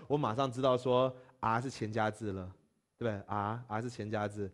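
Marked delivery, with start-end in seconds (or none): none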